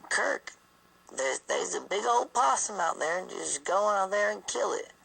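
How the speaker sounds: put-on high old-lady voice urging a kill; great-grandma voice. Great-grandma begging husband to kill the possum, high and urgent. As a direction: play a fussing Southern granny. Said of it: "into the house and say".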